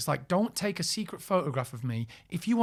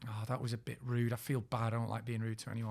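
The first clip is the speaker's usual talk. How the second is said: softer, lower guilty thoughts; guilty inner voice; 5 semitones lower, 8 dB quieter than usual. Retold or imitated. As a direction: think it to yourself, ashamed. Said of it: "so I was thinking".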